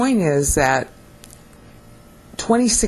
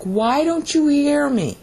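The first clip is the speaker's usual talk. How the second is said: higher, pleading appeal to stubborn listeners; cajoling; 9 semitones higher, slower than usual. Acted. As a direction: coax them gently, asking why they won't listen. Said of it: "Sometimes he cajoles them, like".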